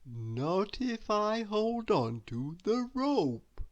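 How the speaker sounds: slow, lazy reassuring drawl; lazy Mister Lazy. A narrator's reading. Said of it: "said Mister Lazy".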